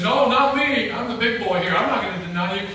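loud, cocky protest; big-shot boasting. Acted. Peter telling Jesus he would never deny him, loud boasting. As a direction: puff it up like the big boy. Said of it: "I was such a big shot".

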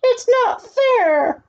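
very high, slow drawn-out wail; spoiled duke wailing that it is unfair; tantrum wail. A narrator's reading. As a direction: cry it out even harder like a spoiled brat. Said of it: "cried the Grand Duke".

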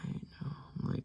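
tiny hushed whisper. Real, not acted. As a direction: whisper it to yourself. Whispered aside.

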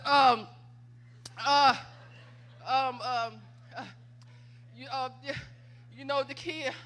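halting imitation of herself lost for words; mock flustered stalling on the phone; caught-out stammer.

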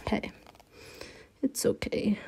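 whispered self-reassurance. Real, not acted. Whispering to herself that the off-centre line is fine. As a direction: drop to a whisper, letting it go. Aside about off-center line.

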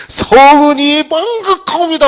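high, slow, formal humble cry; groveling courtier. Retold or imitated. Courtier bowing to king.

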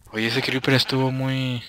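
usual speaking voice

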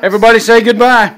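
loud, fast call to the group. Real, not acted. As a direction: call everyone to say bye. Calling everyone for outro.

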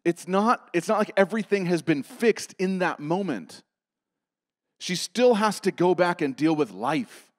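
loud, high, driving sermon delivery; impassioned preaching. Acted. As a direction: preach it hard to the congregation. Preaching at full force.

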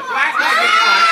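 loud showman announcement, laughing; showman shout. Acted. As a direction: boom it out like a stage magician. Magician's showy announcement.

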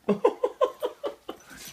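breathy, very high squeal of laughter. Real, not acted. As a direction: burst into a shrieking laugh. Dog reacts to kitten.

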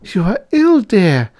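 very high, loud female character voice; high-pitched alarmed girl; anxious young lady. A narrator's reading. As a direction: cry out in sweetheart's concern. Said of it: "the young lady cried".

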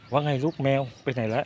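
high, coaxing voice to a kitten. Real, not acted. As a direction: coo at the little cat. Talking to a kitten.

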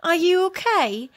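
a hint of a donkey hailing a stranger; concerned donkey. A narrator's reading. Donkey calls to tired dog.

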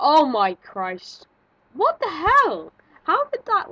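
loud, high burst of shock; startled yell. Real, not acted. Player jolted by a sudden game scare, startled yell.

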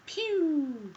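high, loud pew sound; imitated laser pew. Real, not acted. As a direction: make a cartoon blaster noise. Shooting noise.

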